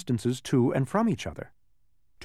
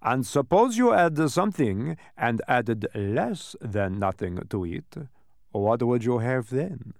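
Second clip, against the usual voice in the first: slow, deliberate character voice; dodecahedron, quizzing; slower than usual. Acted. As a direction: pose the puzzle slowly, like a teacher.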